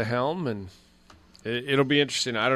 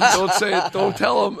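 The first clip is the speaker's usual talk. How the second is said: higher, louder retold plea through laughter; laughing mock plea; 7 semitones higher, 8 dB louder than usual. Host retells begging the videotape not to tell him, laughing plea. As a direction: plead it while cracking up. Retelling his pleas, amused.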